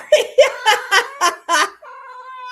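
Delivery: high bursting laugh; real laugh. Real, not acted. Owner laughing as all the cats come, high laugh. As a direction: laugh out loud at the surprise.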